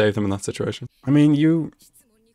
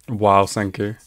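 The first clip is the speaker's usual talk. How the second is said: usual speaking voice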